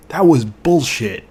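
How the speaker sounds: slow, loud, drawn-out angry verdict on "bullshit"; furious outburst. Real, not acted. Angry rant over the game.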